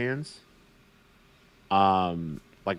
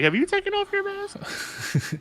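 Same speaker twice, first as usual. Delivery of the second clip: mock high voice. Mocking the Mandalorian's question.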